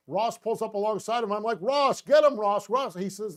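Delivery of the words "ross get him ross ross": much higher, louder excited yell; retold screaming. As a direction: cheer the driver on at full voice. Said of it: "I don't even know what I'm screaming".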